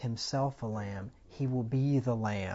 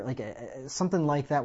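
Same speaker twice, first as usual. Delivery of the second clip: usual speaking voice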